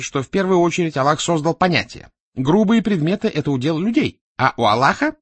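high, loud animated explainer; Березовский. A narrator's reading. Explaining creation to his Chechen opponent, animated and insistent.

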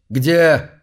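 loud demanding question; требовательно. Acted. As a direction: demand it sharply. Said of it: "потребовал он". (громко, требовательно)

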